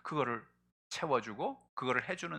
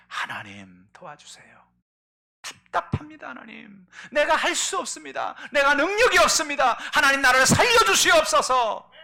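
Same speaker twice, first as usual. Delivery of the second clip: high, loud, desperate crying plea; king's tearful prayer; 14 semitones higher, 12 dB louder than usual. Retold or imitated. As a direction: cry out to God for your life. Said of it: "그가 눈물로 기도합니다". (높고 크게 절박하게 울부짖는 기도)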